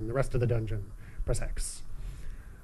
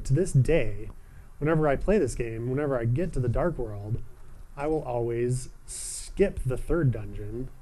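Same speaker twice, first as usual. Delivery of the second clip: ordinary delivery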